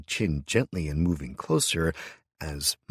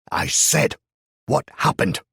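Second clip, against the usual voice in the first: high, loud furious shout; angry repeat; 10 semitones higher, 8 dB louder than usual. A narrator's reading. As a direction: yell it again, losing patience. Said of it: "Mustache cried again".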